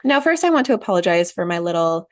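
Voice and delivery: usual speaking voice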